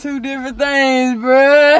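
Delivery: high, slow, shouted protest; loud insistence. Real, not acted. Partner insisting singing isn't saying it, loud protest. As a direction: stretch each word out loudly and high.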